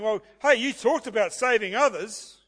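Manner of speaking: preacher voicing the scoffers' mockery, sneering; mocking scoff. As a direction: jeer at him as the rulers would. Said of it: "they scoff by blasphemy".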